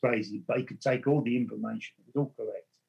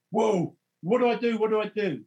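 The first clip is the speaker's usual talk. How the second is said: much higher, alarmed, repeating the question; jolted by a voice out of nowhere; startled panic; 10 semitones higher, 3 dB louder than usual. Retold or imitated. Hears a spirit voice aloud.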